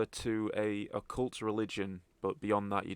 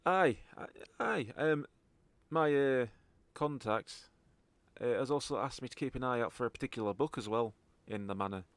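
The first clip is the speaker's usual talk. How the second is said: halting Scottish informant; Scottish accent. Acted. Contact briefing the party on a book, Scottish accent. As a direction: pass on the job in a Scottish accent. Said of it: "properly in a Scottish accent".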